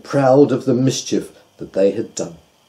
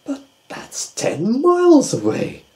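surprised doubt; 13 semitones higher than usual. A narrator's reading. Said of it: "said the Doctor".

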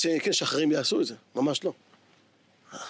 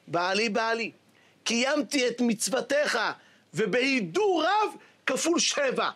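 slow deliberate rabbi; slower than usual. Acted. Rabbi instructs the woman, slowly.

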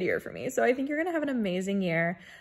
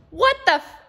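startled; 10 semitones higher, 4 dB louder than usual. Real, not acted. Startled again mid message.

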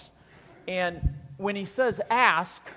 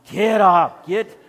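much louder, urgent get up; urgent shout; 11 dB louder, slower than usual. Retold or imitated. Wife rousing husband at midnight, loud and urgent. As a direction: snap him out of bed.

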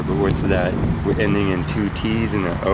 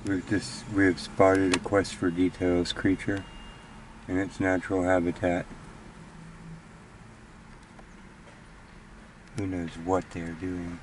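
nature documentary; 9 dB quieter than usual. Pretend. Creature in its natural habitat.

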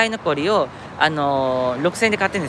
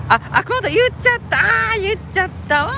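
flustered slip; 13 semitones higher, 3 dB louder than usual. Real, not acted. Slipped and revealed location.

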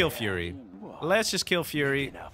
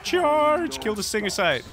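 loud, very high battle shout; rally the troops; battle cry; 10 semitones higher, 5 dB louder, slower than usual. Real, not acted.

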